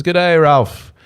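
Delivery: put-on Australian accent. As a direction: put on your best amateur Aussie accent. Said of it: "It's my best Australian accent".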